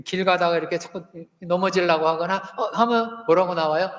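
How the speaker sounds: normal talking voice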